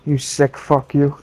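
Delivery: ordinary delivery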